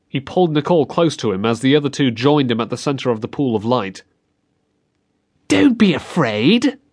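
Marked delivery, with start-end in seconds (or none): 5.5-6.8 put-on higher, slow, sweetly sinister girl; eerie girl's voice. A narrator's reading. Ghostly girl coaxing children from the dark, eerie and slow. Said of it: "it was a girl's voice".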